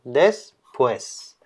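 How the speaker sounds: "Después" said softly as a model, u and e kept together; carefully pronounced. Shown as a demonstration. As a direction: model "Después" as two syllables, diphthong intact. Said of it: "por lo cual no se separarían".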